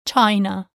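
China with the English eye sound; the wrong English i in China; English-style China. Shown as a demonstration. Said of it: "like in the English word".

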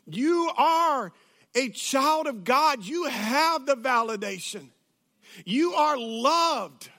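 preacher driving the point home; emphatic preaching. Real, not acted. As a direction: proclaim it with full conviction, stretching the words. Preaches the point at full force.

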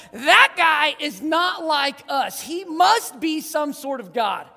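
loud, slow wonder; awed exclamation. Pretend. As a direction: gasp it at a floating head in the clouds. Imagined onlooker in awe.

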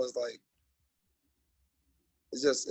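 usual speaking voice

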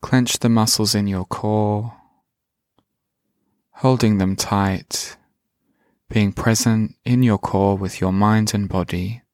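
slow, calm meditation voice; guided meditation. Acted. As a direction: guide the listener gently inward.

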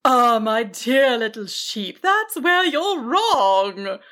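slow, indulgent dragon voice; drawn-out, amused giant creature. A narrator's reading. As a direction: contradict kindly as a big dragon. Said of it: "the dragon chuckled".

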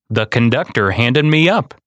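ordinary delivery

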